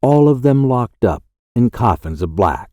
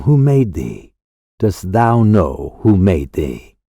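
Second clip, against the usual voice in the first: usual speaking voice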